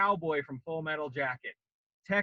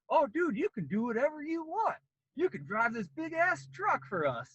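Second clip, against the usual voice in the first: higher, chummy sales pitch; pushy salesman recruiter; 9 semitones higher than usual. Retold or imitated. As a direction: sell it like a used-car dealer. Recruiter selling the Army.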